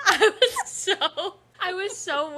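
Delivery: high, slow words broken by laughter; laughing. Real, not acted. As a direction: laugh through it. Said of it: "laughing while retelling".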